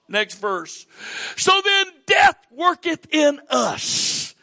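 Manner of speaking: high, loud, impassioned scripture preaching; preacher building to a roar; preaching at full force. Real, not acted. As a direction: thunder each line to the church.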